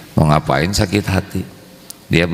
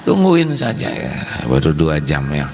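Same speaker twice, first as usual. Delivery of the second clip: high, mocking patient husband; lightly mocking voice of a husband enduring nagging; 13 semitones higher than usual. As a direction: play the husband who just waits it out. Voicing husband waiting out nagging wife.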